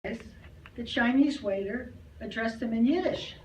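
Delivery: ordinary delivery, nothing marked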